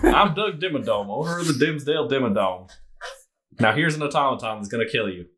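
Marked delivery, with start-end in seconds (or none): 0.0-2.7 hammed-up Texan tycoon voice; mock Southern showman introduction; Doug Dimmadome. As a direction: play a big Texas character, announcing himself. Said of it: "All your dwarves are Doug Dimmadome".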